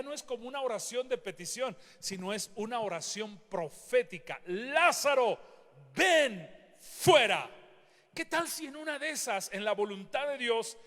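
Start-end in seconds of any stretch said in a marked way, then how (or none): 4.4-7.5 slow, louder prophetic command. Acted. Preacher voicing Jesus at the tomb, firm loud command. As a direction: declare it with authority. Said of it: "Fíjate su oración".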